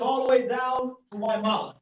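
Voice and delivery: ordinary talk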